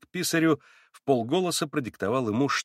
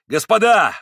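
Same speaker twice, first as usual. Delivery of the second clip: much higher and louder outburst; sudden loud cry; 8 semitones higher, 9 dB louder than usual. A narrator's reading. Mitya suddenly crying out to the investigators, loud. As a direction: burst out all at once. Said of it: "вдруг воскликнул он".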